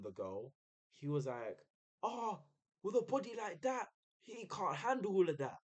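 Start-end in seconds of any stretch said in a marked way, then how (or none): 2.0-5.6 high-pitched mock shout; heckling in a raised, put-on voice; retold shouting. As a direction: imitate a heckler calling out, pitched way up. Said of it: "he was shouting this".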